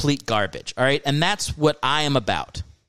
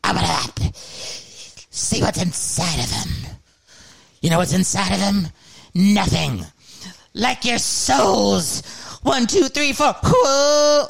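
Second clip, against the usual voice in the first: demon voice; 7 semitones higher, slower than usual. Retold or imitated. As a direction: taunt the crowd like a demon. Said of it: "And he goes".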